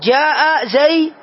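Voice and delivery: "جاء زيد" very slow, the u of زيدٌ sounded, pitched a little up; case ending demo. Shown as a demonstration. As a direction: stretch "جاء زيد" to show the damma. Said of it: "فأقول". (نطق بطيء مع إظهار ضمة "زيد")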